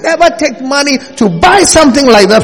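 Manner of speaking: loud, high, rising blessing; preacher blessing the congregation with gifts, full-force prayer; fervent prayer. Acted. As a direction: pray it over them with rising fervour.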